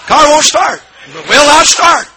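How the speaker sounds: high, loud comic exclamation; emphatic shout. Retold or imitated. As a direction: belt it out for the punchline setup.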